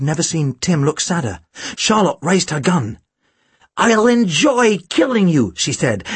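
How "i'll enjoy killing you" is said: high, slow, relishing threat; gloating villain. A narrator's reading. Charlotte aiming her gun at Tim, gloating.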